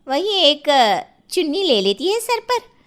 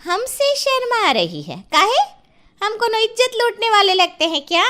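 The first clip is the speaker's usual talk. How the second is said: indignant surprise; 7 semitones higher than usual. Acted. Neighbour offended bahu hides from her.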